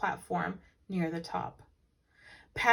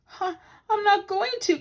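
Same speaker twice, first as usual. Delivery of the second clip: high, louder protest; crying out; 13 semitones higher, 6 dB louder than usual. Acted. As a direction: squeal it back from midair. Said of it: "cried Paddington".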